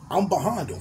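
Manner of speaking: usual speaking voice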